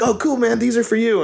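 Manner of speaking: high, bright mock-cheerful hand-off; cheery fake-casual "These are for you"; fake-friendly. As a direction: sweetly hand over the papers. Serving the legal papers cheerfully.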